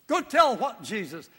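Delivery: normal talking voice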